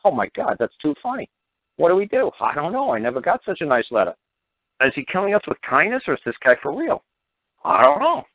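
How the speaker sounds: high, flustered chatter of stunned clerks; stunned agents reading an unexpectedly kind letter, high and flustered; baffled office workers. Retold or imitated. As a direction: play the confused agents passing the letter round. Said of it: "pass the letter around and say".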